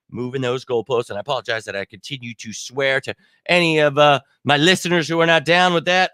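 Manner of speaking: usual speaking voice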